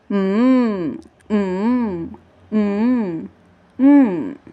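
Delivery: long, drawn-out yummy hum; savouring "mm". Shown as a demonstration. Teacher tells children to rub tummy, savouring hum. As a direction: hum as if relishing tasty food. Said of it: "tasty food and say".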